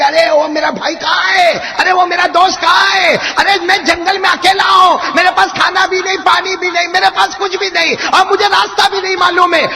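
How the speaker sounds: high, loud calls for his companions; lost companion shouting into the empty jungle; desperate shouting. Acted. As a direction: shout for lost friends in the wilderness. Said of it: "زور زور سے سب کو چلانے لگے".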